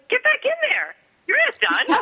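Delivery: high-pitched imitation of a bossy husband; comic, insistent retold command; mock order. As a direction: playfully order someone back to work. Retold husband ordering her back.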